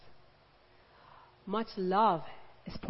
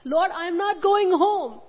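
Mary pleading; 6 semitones higher, slower than usual. Retold or imitated. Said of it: "She said".

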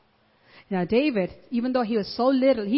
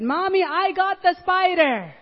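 put-on proud child's voice; 6 semitones higher than usual.

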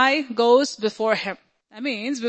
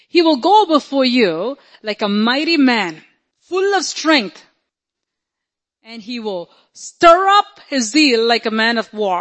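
full-force preaching; 4 dB louder than usual. Acted. Preacher declaring a mighty warrior God to the congregation, full force. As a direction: proclaim it with swelling power.

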